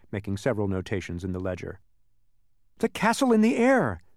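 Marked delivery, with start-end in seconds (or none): 2.8-4.0 high, impatient reply. A narrator's reading. Milo answering the census taker, impatient. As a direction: answer with raised, exasperated voice. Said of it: "said Milo impatiently".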